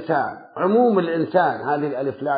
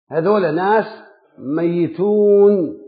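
ordinary talk, nothing marked

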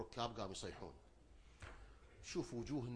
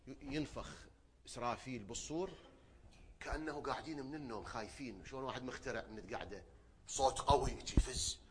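ordinary talk

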